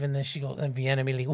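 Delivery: ordinary talk, nothing marked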